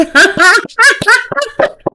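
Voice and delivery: a high, loud burst of laughter; shrieking laugh. Real, not acted. Laughing hard at her own reading. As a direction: crack up into a squealing laugh.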